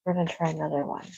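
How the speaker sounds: usual speaking voice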